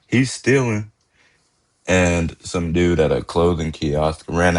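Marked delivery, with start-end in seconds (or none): 0.1-0.9 flat, bored monotone. Retold or imitated. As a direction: say it with zero urgency. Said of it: "She just says in monotone".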